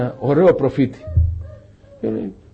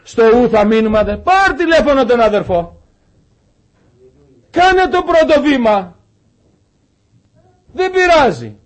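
high, raised preaching voice, urgent; 9 semitones higher, 4 dB louder than usual. Acted. Preacher urging the congregation back to its purpose, raised and urgent. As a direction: exhort the brothers with rising urgency. Preacher exhorts to call brothers.

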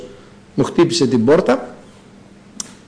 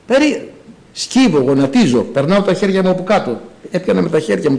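ordinary talk, nothing marked

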